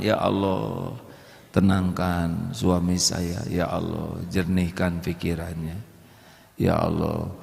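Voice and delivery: quiet, low, slow prayer; quiet whispered-like plea to God; soft prayer. Pretend. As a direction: pray gently for the husband's calm. Praying for calm husband.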